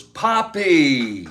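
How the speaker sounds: high, loud delighted call of Poppy; excited cry. Retold or imitated. Daughter sees her father and cries out joyfully. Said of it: "she goes".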